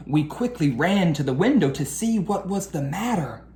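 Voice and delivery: lively storybook read-aloud; reading to children. A narrator's reading. Teacher reading a picture book to her class, lively storytelling. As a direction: read the rhyming story to the kids.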